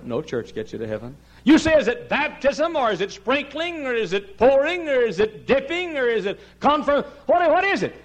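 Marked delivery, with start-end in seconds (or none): 1.4-7.9 high, ringing list of questions; insistent high preacher's cadence; forceful preaching. Real, not acted. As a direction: fire off each challenge from the pulpit.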